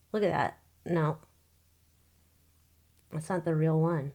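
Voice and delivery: ordinary delivery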